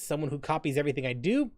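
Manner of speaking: usual speaking voice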